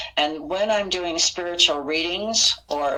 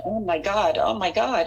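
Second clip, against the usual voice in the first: mock distraught cry, pitched higher; higher, mildly hammed-up distress; retold hysterical crying; 4 semitones higher than usual. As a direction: re-enact being overwhelmed, without real sobs. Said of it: "I started hysterically crying".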